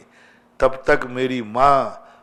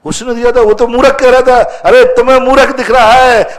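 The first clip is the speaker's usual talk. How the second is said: loud, high-pitched acted exchange; impassioned retort; 9 semitones higher, 11 dB louder than usual. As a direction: exclaim it forcefully, voice raised right up. Acted exchange, climax of parable. (जोश में ऊँची आवाज़ का संवाद)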